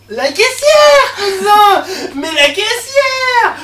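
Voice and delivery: high, drawn-out loud yell; alarmed shout. Acted. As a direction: shout it out, stretching the last syllables. Horrified outburst about the cashier.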